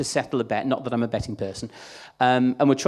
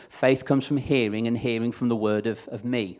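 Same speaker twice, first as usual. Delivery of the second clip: ordinary talk, nothing marked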